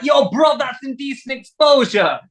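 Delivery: high-pitched, indignant mimicked bloke; outraged passer-by. Stranger opening the van door on naked lads, outraged call.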